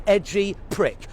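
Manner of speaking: ordinary talk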